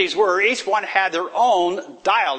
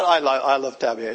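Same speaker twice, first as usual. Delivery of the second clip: normal talking voice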